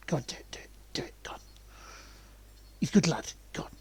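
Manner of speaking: conspiratorial whispered urging. Real, not acted. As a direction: egg them on in a hushed whisper. Urging viewers to follow.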